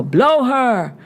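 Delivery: high, sharp yell of command. A narrator's reading. Jordan yells to Anselmo to blow the bridge. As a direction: bark the order across the road. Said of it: "yelled to Anselmo".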